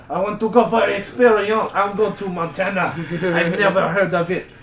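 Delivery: a bold Frenchman declaring plans; French adventurer. Retold or imitated. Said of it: "it's the same fucking vibe".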